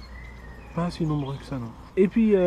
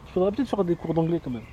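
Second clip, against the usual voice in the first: ordinary delivery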